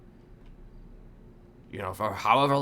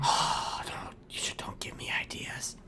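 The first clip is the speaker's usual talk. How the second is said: hushed gasp, then a whispered plea; mock whisper; 5 dB quieter than usual. Whispering to friends who gave him a pumpkin idea. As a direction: breathe it like a tempted secret.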